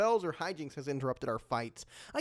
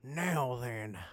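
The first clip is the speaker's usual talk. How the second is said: ordinary talk, nothing marked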